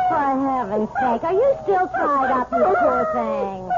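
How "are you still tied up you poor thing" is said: put-on high, slow baby talk; cooing to the dog. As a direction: coo like to a pet.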